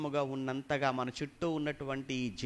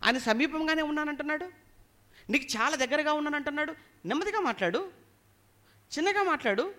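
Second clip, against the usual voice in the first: mock remonstrance; 9 semitones higher, 4 dB louder than usual. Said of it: "ఎందుకయ్యా నువ్వు గట్టిగా అరుస్తున్నావు?".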